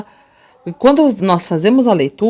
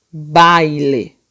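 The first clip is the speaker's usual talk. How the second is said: BAILE stretched out for the class; slow demonstrative reading; 3 semitones lower than usual. Shown as a demonstration. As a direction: read BAILE slowly, lingering on the vowels. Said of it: "Vamos ler todo mundo junto".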